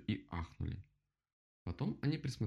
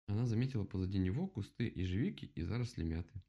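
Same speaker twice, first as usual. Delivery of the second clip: ordinary delivery, nothing marked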